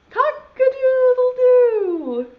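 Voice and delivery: loud, high, drawn-out crow; rooster crow. Acted. Teacher leading children in the rooster's call, crowing. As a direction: crow it slowly and loudly like a rooster. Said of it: "just like that".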